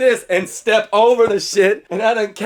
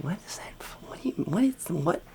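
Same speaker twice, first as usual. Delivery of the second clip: whispered, puzzled questions; confused whisper; 14 dB quieter than usual. Retold or imitated. Kid puzzled by the bully's odd stick, whispered. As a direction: breathe the questions out, baffled.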